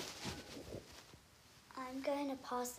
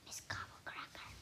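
very soft, fearful whisper of a name; 12 dB quieter than usual. A narrator's reading. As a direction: whisper it as if she might hear. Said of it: "you have to whisper your name when you say it like this".

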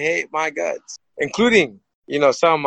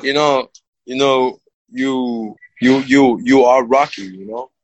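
slow, low imitation of the film star; Sylvester Stallone; 4 semitones lower, 3 dB louder, slower than usual. Movie star tells the fighter who he really is, slow low impersonation. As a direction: drop low and draw out each "you". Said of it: "He goes".

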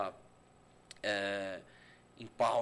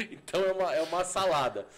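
laughing; 7 semitones higher, 8 dB louder, faster than usual. Real, not acted. Laughing at the odd mix.

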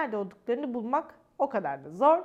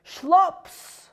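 splash sound; 5 semitones higher, 10 dB louder than usual. Acted.

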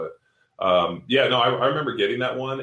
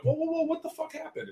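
high-pitched, softer mock disbelief; retold alarm; 5 dB quieter than usual. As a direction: act out a startled "whoa".

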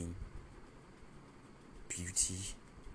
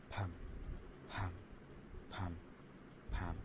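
heartbeat imitation; 9 dB quieter than usual. Hearing her heartbeat while holding her, soft thumps. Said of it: "I can hear her heartbeat".

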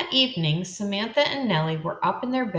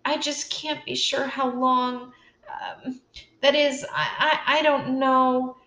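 faltering; 7 semitones higher than usual. A narrator's reading. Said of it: "Then Nellie faltered".

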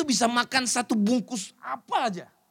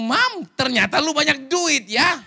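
1 teasing surprise; 7 semitones higher, 9 dB louder, slower than usual. Retold or imitated. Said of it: "saya bilang".

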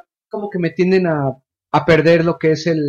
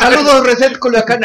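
loud, laughing greeting; laughing shout-out; 6 semitones higher, 5 dB louder than usual. Real, not acted. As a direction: call out while laughing.

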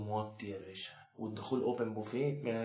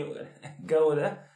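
laughing; 5 semitones higher, 8 dB louder, much slower than usual. Real, not acted. Laughing mocking couple's songs.

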